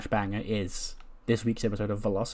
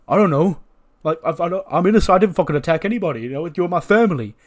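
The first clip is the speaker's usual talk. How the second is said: raised, hurried, indignant denial; protesting innocence; 5 semitones higher, 5 dB louder than usual. Acted. Jacob denies the attack to his family, indignant. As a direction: protest loudly and fast.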